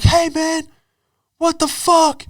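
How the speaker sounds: very high, strained mock scream; screaming cyclist. Hit cyclist screaming at the cab driver, high-pitched mock scream. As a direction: imitate an outraged yell in a squeezed high voice. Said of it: "He starts screaming at the cab driver".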